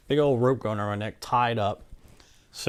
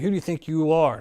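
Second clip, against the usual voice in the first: imitating an angry outburst, higher and a bit louder; mock angry doctor; 6 semitones higher, 6 dB louder than usual. Retelling the doctor's angry outburst at him, in mock anger. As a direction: throw it back at him the way he snapped it. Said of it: "he got all pissed".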